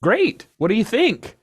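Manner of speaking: high, loud eager pitch; excited. Acted. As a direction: show it off with glee. Gordon shows off drone.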